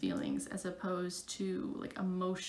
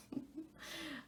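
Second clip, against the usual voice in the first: short, quiet, higher-pitched chuckle; soft laugh; 8 semitones higher, 14 dB quieter than usual. Real, not acted. Vlogger wrapping up the video, laughing softly. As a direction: let out a small real laugh. Laughs alone after signing off.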